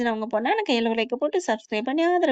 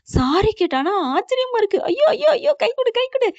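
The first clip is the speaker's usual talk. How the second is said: much higher, squealing congratulations; amazed excitement; 11 semitones higher, 4 dB louder than usual. Acted. Friend congratulates Mathi on the apology, amazed excitement. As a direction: burst out in giddy astonishment.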